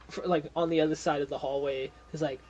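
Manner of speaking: usual speaking voice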